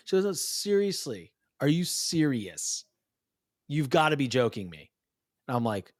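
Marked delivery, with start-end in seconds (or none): 0.3-4.9 insistent disbelief. Retold or imitated. Daughter presses her confused dad, mock exasperated. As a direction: imitate a kid drawing out her disbelief.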